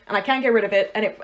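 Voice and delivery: ordinary delivery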